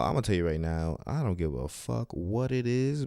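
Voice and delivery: ordinary talk, nothing marked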